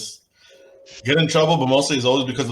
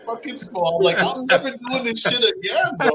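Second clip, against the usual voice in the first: laughing; 11 semitones higher than usual. Real, not acted. Laughing while retelling humiliation.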